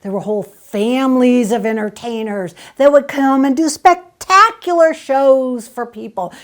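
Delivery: normal talking voice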